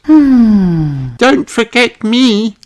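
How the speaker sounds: put-on high, playful little-girl plea; Zoe Zebra's voice. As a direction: ask not to be left out, giggling. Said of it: "said Zoe Zebra".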